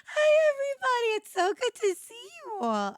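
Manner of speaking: very high, choked-up squeal of welcome; real tearful-sounding joy; emotional greeting. Real, not acted. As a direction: greet them overcome, voice soaring high. Emotional return greeting to audience.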